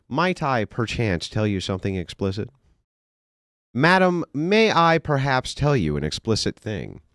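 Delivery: high-pitched, over-polite mock courtliness; mock-formal gentleman. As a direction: ham it up as a fussy old-world suitor. Mock-formal gentleman delivering pickup line.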